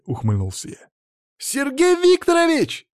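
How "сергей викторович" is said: very high, drawn-out cry; children's exclamation. A narrator's reading. Pupils suddenly notice their teacher, high-pitched exclamation. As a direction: squeal it like startled kids, stretching it. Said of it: "воскликнули бесята".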